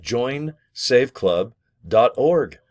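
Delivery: drilled web address, slow; slow repeat of address. Shown as a demonstration. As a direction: join save club dot org slowly again, org held long. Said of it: "Can you remember that?".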